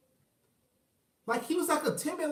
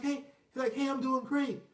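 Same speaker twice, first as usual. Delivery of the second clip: higher, raspy, meek reply; imitated raspy voice; 4 semitones higher, 4 dB quieter than usual. Timid school dad answering another father, raspy meek impression. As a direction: mimic a lifeless raspy-voiced man. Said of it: "what the fuck is wrong with your voice".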